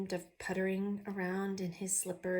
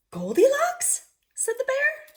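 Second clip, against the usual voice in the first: much higher, louder excited cry; 10 dB louder than usual. Acted. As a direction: call out thrilled at the reunion. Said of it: "I said it like he was excited".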